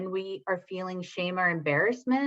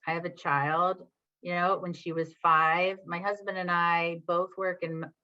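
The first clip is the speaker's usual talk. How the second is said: ordinary talk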